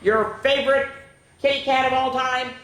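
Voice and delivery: ordinary delivery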